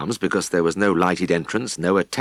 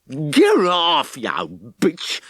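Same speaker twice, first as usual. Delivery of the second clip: angry yell at the cow; 8 semitones higher, much slower than usual. A narrator's reading. Farmer shouting at the cow on his toe, angry yell. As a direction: yell it at the beast pinning you. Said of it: "beating on the hairy back".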